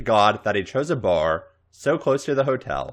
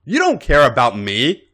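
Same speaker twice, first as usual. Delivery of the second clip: loud, high slurred outburst; drunken slur; 7 semitones higher, 7 dB louder than usual. Acted. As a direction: slur it like you've had eight beers. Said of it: "she slurred".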